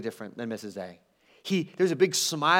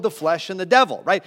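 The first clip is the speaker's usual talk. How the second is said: ordinary talk, nothing marked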